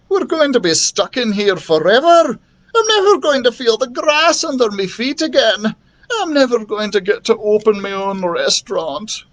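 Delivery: high-pitched, hammed-up wail; despairing story-character voice, breaking into sobs; crying lament. A narrator's reading. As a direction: whine it like a sobbing character. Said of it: "Lucas began to cry again".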